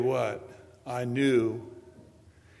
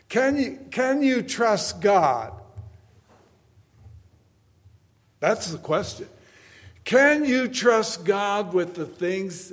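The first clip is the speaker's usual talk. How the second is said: slow, loud, high preacher's cry; booming sermon delivery; full force preaching; 9 semitones higher, 7 dB louder, slower than usual. Acted. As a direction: hammer each line home to the congregation.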